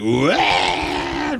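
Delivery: imitated wailing yell; mock scream of someone running for cover; drawn-out comic "wah" yell; brother's panicked yell. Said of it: "my brother's like".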